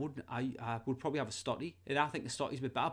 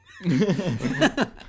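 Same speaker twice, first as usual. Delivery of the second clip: loud laugh; 5 semitones higher, 10 dB louder than usual. Real, not acted. Laughing at own joke.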